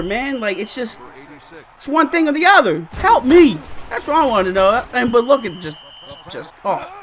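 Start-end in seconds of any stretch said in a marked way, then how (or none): 2.9-3.6 higher, louder cry for help; frustrated plea. Real, not acted. Gamer begging his team to catch, frustrated plea. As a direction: beg the screen for help. Gamer pleading over bad play.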